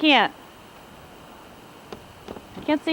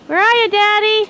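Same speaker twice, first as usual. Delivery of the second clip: calling up to window; 9 semitones higher, 5 dB louder than usual. Real, not acted. Looking for dad on roof.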